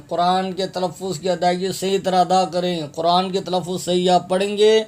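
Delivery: normal talking voice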